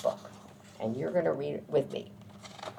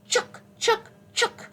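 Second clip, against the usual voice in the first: high, sharp squirrel chucks; squirrel chatter; 3 dB louder than usual. A narrator's reading. Teacher showing children what mama squirrel says, high squirrel chatter. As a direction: squeak it out in quick separate bursts. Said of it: "she chattered in the low branches".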